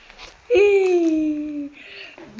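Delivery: loud, high gasp of delight that spills into laughter; breathless happy gasp; laughing gasp. Real, not acted. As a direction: gasp like you can't believe your luck. Overjoyed at his own aim.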